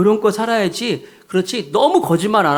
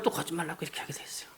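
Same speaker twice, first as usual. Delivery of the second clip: whisper; 16 dB quieter, faster than usual. Real, not acted. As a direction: drop to a secret whisper. Preacher drops to whisper. (속삭이듯 비밀스럽게)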